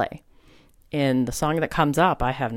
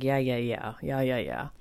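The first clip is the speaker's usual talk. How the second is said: softer, brushing it off; a quiet eye-roll of a yeah; dismissive yeah-yeah; 5 dB quieter than usual. Retold or imitated. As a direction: wave it away, unconvinced. Dismissing the hawk signs.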